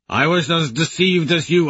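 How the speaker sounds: a hint of an old lord pleading innocence; calling across to Aja; old warrior calling. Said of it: "Lorn calls".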